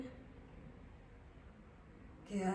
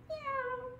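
high, soft kitten meow; cat meow; 12 semitones higher, 6 dB quieter than usual. Shown as a demonstration. Instructor answering what the cat says, high soft meows. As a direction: mew softly up high like a cat. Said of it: "What does the cat say?".

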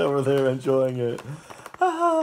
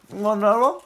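talking to the dog; 8 semitones higher, 4 dB louder, much faster than usual. Real, not acted. Offering the dog another chip.

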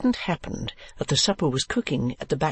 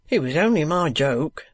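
plain reading voice

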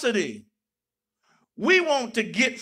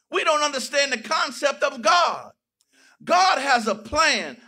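usual speaking voice

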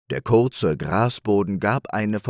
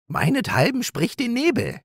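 magnanimous. Acted. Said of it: "gestattete der Hase großmütig".

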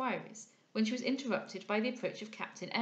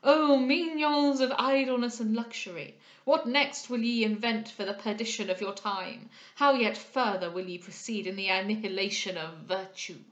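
loud exclamation; 7 dB louder than usual. A narrator's reading. Old moralist scolding the idle rich from afar, loud and declamatory. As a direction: preach it loudly at idle society. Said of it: "exclaimed a voice at some distance".

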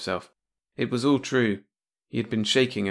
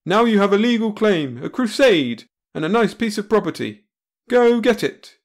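grand declaration; 10 semitones higher, 6 dB louder than usual. Acted. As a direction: announce it like a hero. Brad's grand speech.

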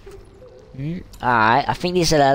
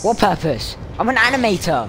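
loud, higher-pitched retort; indignant protest; 6 semitones higher, 5 dB louder than usual. Real, not acted. Player objecting to the game voice's talk of purpose, indignant. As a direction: answer back loudly in protest.

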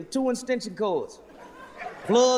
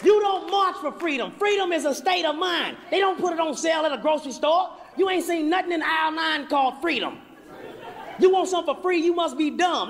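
preaching rant; 8 semitones higher than usual. Acted. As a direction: preach it at the crowd with full force.

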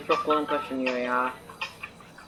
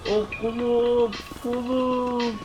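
hammed-up pain; 7 semitones higher, much slower than usual. Arm bitten, in pain.

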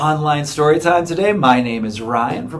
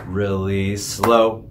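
slow demo; 9 semitones lower, 4 dB quieter, slower than usual. Shown as a demonstration. Librarian showing kids how slow to clap, said slowly. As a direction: stretch it out slowly. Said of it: "We're gonna clap our hands really".

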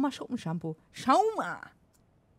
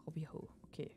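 whisper; 6 semitones lower, 14 dB quieter than usual. Real, not acted. Whispered aside.

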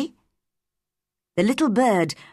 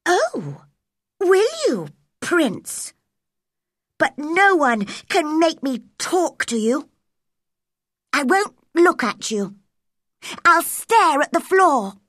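loud, raised, mocking defiance; defiant girl; 9 semitones higher, 3 dB louder than usual. A narrator's reading. Angry girl Mary defies the bossy prince, loud and scornful. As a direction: throw it back at him scornfully.

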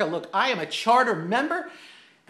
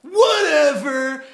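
louder, higher, slow drawn-out brush-off; dismissive punk; 7 semitones higher, 9 dB louder, much slower than usual. Retold or imitated. Defeated punk brushing off the cigar man, loud and drawn out. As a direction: imitate the beaten punk's sulky dismissal. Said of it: "He looks at me and he says".

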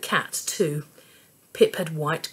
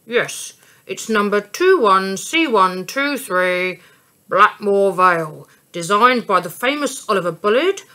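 louder, proud train-buff voice; train enthusiast; 4 dB louder than usual. A narrator's reading. Ticket man proudly telling Mum about the engine. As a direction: reel off the facts with pride.

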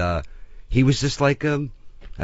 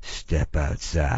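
low, drawn-out film-star command; Clint Eastwood; 5 semitones lower, 4 dB quieter, much slower than usual. Retold or imitated. Star ordering the coughing extra out, low, slow, understated menace. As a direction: imitate a quiet order, each word very slow. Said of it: "He goes".

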